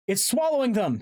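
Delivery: high, loud, panicked call; horrified cry. A narrator's reading. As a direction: cry out in horror. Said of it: "Tobias cried".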